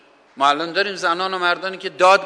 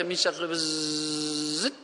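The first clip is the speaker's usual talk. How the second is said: buzzing fly; 7 dB quieter, slower than usual. Retold or imitated.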